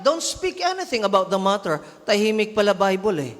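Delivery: ordinary talk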